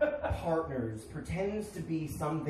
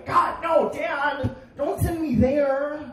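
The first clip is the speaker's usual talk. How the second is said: high, loud hammed-up begging; mock pleading; 10 semitones higher, 4 dB louder, much slower than usual. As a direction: shout a melodramatic plea to stop him.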